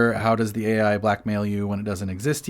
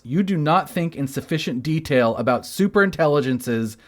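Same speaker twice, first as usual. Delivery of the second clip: higher, emphatic quote reading; all caps reading; 5 semitones higher, 3 dB louder than usual. Acted. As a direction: read it out like it's typed in capitals. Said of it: "All caps".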